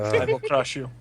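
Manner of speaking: put-on heavy Russian accent, menacing; low Russian menace. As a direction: threaten with a thick Russian accent. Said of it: "I'm intimidating the German by using Russian accent".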